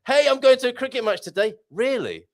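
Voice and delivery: ordinary talk, nothing marked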